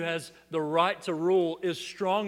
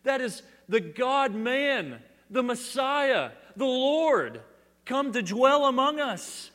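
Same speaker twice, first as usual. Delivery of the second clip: exultant declaration; 7 semitones higher, 5 dB louder, slower than usual. Retold or imitated. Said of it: "clearly enough to say".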